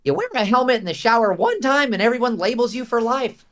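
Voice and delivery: high, loud teen boy cartoon voice; Marco character line. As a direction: deliver it as the safe kid, whiny and indignant. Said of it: "he has a line where he says".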